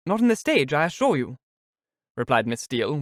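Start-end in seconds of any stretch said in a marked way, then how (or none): 0.1-1.4 high, loud, triumphant burst; quick exultation. Acted. Miss Steele boasts to Mrs Jennings, exulting. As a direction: crow it out quickly. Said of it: "with quick exultation".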